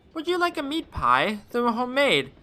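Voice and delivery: put-on high, sweet old-lady voice. Novelist lady greeting the detective in a high sweet voice. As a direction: coo like a kindly grandmother.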